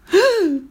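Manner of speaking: short high, loud scream of fright; startled shriek. A narrator's reading. Two ladies see a head at the window, startled shriek. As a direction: let out a sharp high cry. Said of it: "poussèrent de grands cris".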